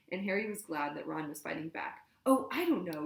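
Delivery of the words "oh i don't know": loud, cutting sarcasm; mock him cruelly; sarcastic yell. A narrator's reading. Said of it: "yelled Hermione with awful sarcasm".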